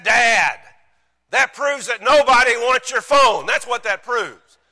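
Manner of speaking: loud, high mock lecture to Dad; exasperated son. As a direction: imitate a teenager bluntly setting Dad straight. Son corrects father, retold.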